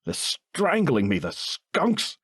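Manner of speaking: high, frantic cry; panicked. Acted. Husband in panic after nightmare, frantic high voice. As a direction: gasp it out in panic.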